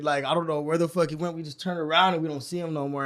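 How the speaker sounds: ordinary talk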